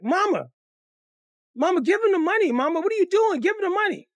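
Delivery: put-on high, wailing kid's plea; loud, high-pitched acted begging; begging children. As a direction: plead like frantic little children. Children beg mother.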